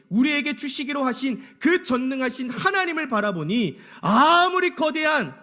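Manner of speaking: high, loud, impassioned sermon delivery; full-force preaching. Real, not acted. Preacher contrasts fearful spies with faith in God, impassioned full force. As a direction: drive it up to a stretched, shouted peak.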